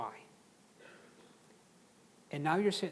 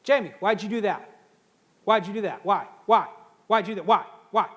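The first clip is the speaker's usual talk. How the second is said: loud, higher, pressing why again and again; demanding why; 3 semitones higher, 12 dB louder than usual. Shown as a demonstration. Boss interrogating an employee, loud and pushy. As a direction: hammer the listener with why. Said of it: "I'd come and say".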